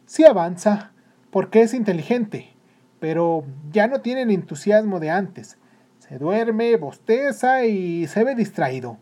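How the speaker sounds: teacher listing a pupil's faults; concerned teacher. Acted. Teacher reports on the boy.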